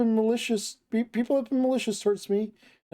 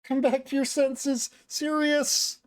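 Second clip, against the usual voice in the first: high, drawn-out cry of a name; loud calling out; 6 semitones higher, much slower than usual. Acted. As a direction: call someone back from far away. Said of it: "shout over and over with that stupidly loud voice".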